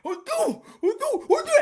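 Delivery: short high hoots, blurred words; mock high deaf voice; imitated deaf arguer. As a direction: mimic a deaf person spitting out words.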